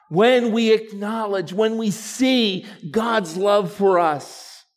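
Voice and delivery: loud, high preaching, building; full-force preaching. Acted. Pastor rousing the congregation with full preaching. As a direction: preach it out to the church.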